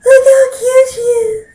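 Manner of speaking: high, loud, slow squeal near tears. Real, not acted. Owner overwhelmed by her cat, high squealing gush. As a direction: stretch it out in overwhelmed delight.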